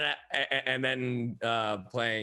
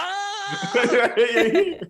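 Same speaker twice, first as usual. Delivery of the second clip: high, loud "ohh!" through laughter; hammed-up cry of surprise; big laughing exclamation; retold excited "oh!"; 10 dB louder than usual. Retold reaction at the audition.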